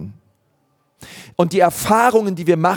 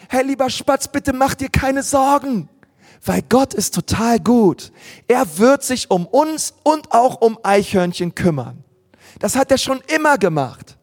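reassuring friends; 6 semitones higher than usual. Acted. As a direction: tell him not to worry. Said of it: "jedes seiner Freunde sagt".

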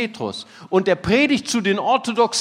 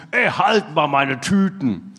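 low, slow mock-grumpy order; gruff, brusque; 4 semitones lower than usual. Klaus hands the pastor his shopping bags, gruffly. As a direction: imitate a surly man giving a curt order. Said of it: "sagte so ganz unwirsch".

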